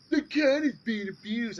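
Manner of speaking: high, loud sneering mock outrage; mocking whiny complainer. Mimicking commenters who claim the cat is abused, high loud sneer. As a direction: parody the critic's fake concern. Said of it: "these fucking fuckbags that just bitch and moan".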